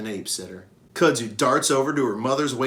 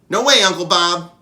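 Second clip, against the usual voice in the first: put-on louder, firm childish refusal; 7 semitones higher, 10 dB louder than usual. Young Ruby refusing her uncle firmly, louder. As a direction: dig her heels in. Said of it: "just as firmly".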